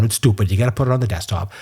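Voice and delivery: normal talking voice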